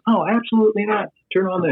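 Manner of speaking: high, bright mock eagerness; eager agreement. Kurt Warner eagerly telling the nervous teen to start recording, high and keen. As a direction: play the star gladly saying yes.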